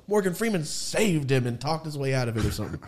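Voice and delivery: ordinary talk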